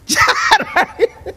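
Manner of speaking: laughing high through the words. Acted. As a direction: break into laughter while saying it. Laughing crowd, comic repeat.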